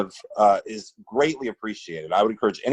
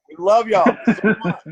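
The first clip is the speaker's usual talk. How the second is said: laughing; 9 semitones higher, 6 dB louder than usual. Real, not acted. Laughing affection to audience.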